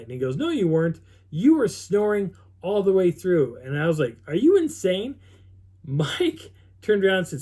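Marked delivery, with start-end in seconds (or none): 0.4-3.6 higher, louder retold protest; emphatic contradiction. Friend disputing his claim he was up all night, emphatic contradiction. Retold argument over who snored. 4.3-5.2 high, loud incredulous question; indignant disbelief. Retold or imitated. Accused camper answering his friend about snoring, indignant disbelief.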